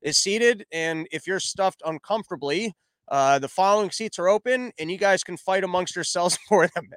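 ordinary talk

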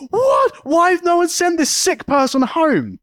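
mock horrified shriek; shocked outrage. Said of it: "Again, I was like".

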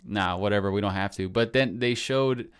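normal talking voice

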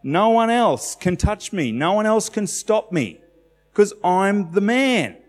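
high, slow, emphatic proclamation; voicing Jesus, bold. Preacher voicing Jesus to the congregation, high and triumphant. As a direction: declare it with unshakable confidence.

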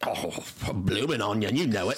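put-on Aussie accent; Australian accent. Mock Australian answering about onions, put-on Aussie accent. Playing an Australian being interviewed.

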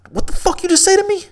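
high, sharp mock confrontation; menacing retort. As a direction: act out the stranger turning hostile. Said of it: "he turns around and looks at me and says".

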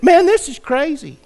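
angry outburst. Retold or imitated. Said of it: "He got mad".

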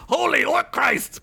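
high, startled exclamation. Acted. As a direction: jump at the scare and blurt it. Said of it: "Boris said with a start".

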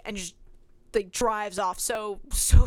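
ordinary delivery

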